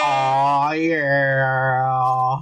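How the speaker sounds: low, long-held oh yeah; a long, low party-horn holler; drawn-out catchphrase. Pretend. His drawn-out catchphrase after a countdown.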